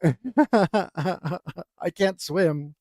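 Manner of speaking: very slow, laughing through it. Real, not acted. Narrator recalls he can't swim, laughing slowly. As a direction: stretch it out, amused at himself. Laughing at his own danger.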